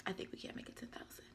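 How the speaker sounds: very soft, quick near-whisper; barely voiced, rapid aside; hushed aside. Real, not acted. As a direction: whisper it quickly, almost to yourself. Quiet aside after asking viewers.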